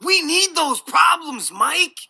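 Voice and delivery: slow, high shout of outrage. Real, not acted. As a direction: bellow it, furious at the rioters. Ranting about rioters.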